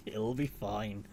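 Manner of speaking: drawn out slowly and softly; a slow, hushed It'll be fine; slow, quiet reassurance. Shown as a demonstration. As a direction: ease it out, half to yourself. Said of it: "repeated slower and softer".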